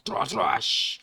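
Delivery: two whooshy vocal slashes; comic-book battle noise; slash sound effect. Acted. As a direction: mimic blades striking twice. Imitated sword clash noises.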